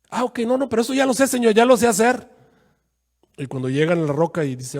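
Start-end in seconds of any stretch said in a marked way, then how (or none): 0.1-2.3 high, cocky brush-off; cocky know-it-all. Retold or imitated. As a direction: wave off the advice, proud. Moisés presume ante Dios. (arrogante y agudo, quitándole importancia)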